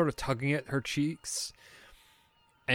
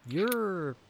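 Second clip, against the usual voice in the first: puzzled, halting and higher-pitched; confused; 5 semitones higher, slower than usual. Acted. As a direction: falter between words as if sizing up a stranger. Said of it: "she's very confused".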